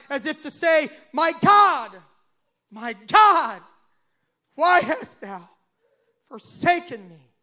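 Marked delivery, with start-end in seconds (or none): 1.1-7.3 loud retold cry fading at the end. Jesus calling to God from the cross, crying out. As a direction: call out to God, then let it sink. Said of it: "he cried out".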